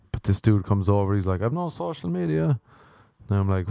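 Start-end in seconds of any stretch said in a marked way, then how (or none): 1.4-2.6 high, light take on the visitor; a hint of a smug young man; lightly voiced guy. Imitating the flatmate candidate.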